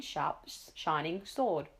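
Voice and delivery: ordinary delivery, nothing marked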